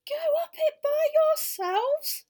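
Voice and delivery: shrill, very high, loud, slow gasp of horror; horrified. Acted. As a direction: recoil in horror as the little elf. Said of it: "in horror".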